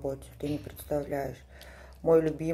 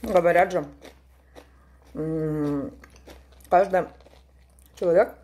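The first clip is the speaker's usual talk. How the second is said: chewing while talking; eating sushi roll; mouth full; 4 dB louder than usual. Real, not acted. Seen 1: She puts a roll in her mouth, then chews with puffed cheeks while speaking.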